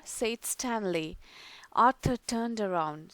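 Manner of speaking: ordinary delivery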